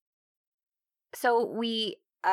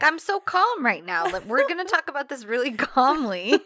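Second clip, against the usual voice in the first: higher and louder, sarcastically insisting on calm; hammed-up fake serenity; mock calm; 3 semitones higher, 7 dB louder than usual. Imitating John claiming calm, mock insistence.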